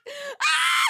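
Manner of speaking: high, loud scream into laughter; shriek, then cackle very high. Real, not acted. Streamer after her daddies joke, shrieking laugh.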